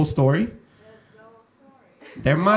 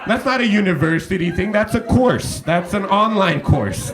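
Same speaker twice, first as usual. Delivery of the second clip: higher-pitched, mock-incredulous telling-off; exasperated retort; 6 semitones higher than usual. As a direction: scold him like a mate who's gone too far. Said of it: "I'm like".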